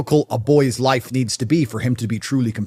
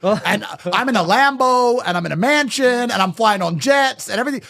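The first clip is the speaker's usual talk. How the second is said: imitated daughter to boyfriend; 6 semitones higher than usual. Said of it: "She's gonna say to her boyfriend".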